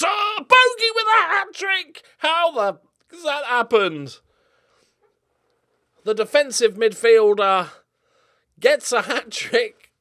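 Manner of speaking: high, loud, slow disbelieving shout; shouting as his midfielder bags a hat-trick; astonished yell. Real, not acted. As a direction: cry out in amazement.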